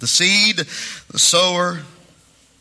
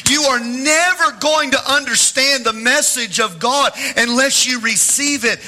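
higher, ringing preacher's delivery at full force; preaching; 9 semitones higher, 4 dB louder than usual. Acted. Pastor preaching faith to his congregation, full-force preaching.

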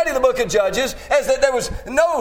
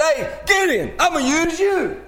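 lightly voiced God calling, pitched higher; mild calling out; 6 semitones higher than usual. Preacher as God hailing Gideon, mildly calling out. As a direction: call him over in a raised pitch.

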